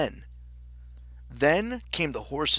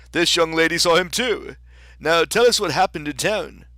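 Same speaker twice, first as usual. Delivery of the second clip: loud, higher storybook king; 4 semitones higher, 8 dB louder than usual. Acted. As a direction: give orders as a king.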